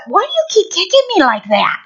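high, indignant horse voice; complaining horse. Acted. Horse protests to rider about spurring, high indignant voice. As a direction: protest in a high, hammed-up whine. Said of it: "all of a sudden your horse".

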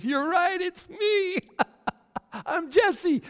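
very high, loud gleeful squeal with a laugh; acted drunken glee. Drunk stranger owning up to being Jesse, high-pitched laughing glee. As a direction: whoop like a delighted drunk.

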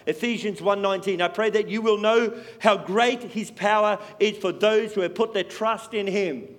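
loud, measured reading of verses; scripture read aloud. Acted. Preacher reading Bible verses aloud to the congregation. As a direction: declare the verses to the church.

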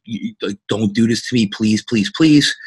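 retold tearful plea to his wife; tearful pleading. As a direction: beg her not to go, voice rising to a cry. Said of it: "I literally broke down and I was crying".

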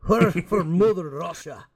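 lightly voiced Scottish accent on Hurrah for Mother Russia; a hint of Connery's Scottish Russian. Mocking Connery as a Russian, light Scottish accent. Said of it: "Sean Connery play a Russian with the Scottish accent".

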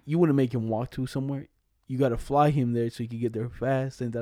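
ordinary talk, nothing marked

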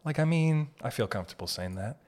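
normal talking voice

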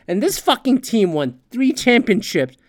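ordinary talk, nothing marked

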